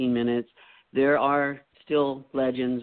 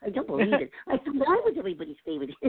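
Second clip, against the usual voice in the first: higher, fast cartoon character voice; 9 semitones higher, faster than usual. Shown as a demonstration. Guest keeps doing impressions, high and quick.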